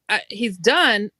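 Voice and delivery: ordinary delivery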